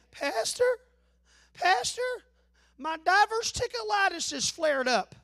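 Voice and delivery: high, pleading church member; mock a complaining caller begging for sympathy; whiny caller. Church member calling the pastor.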